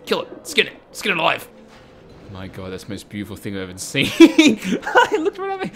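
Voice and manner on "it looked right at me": high, loud, slow, through laughter; laughing shriek. Real, not acted. Player caught by creature's gaze, high laughing shriek. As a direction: squeal it while cracking up.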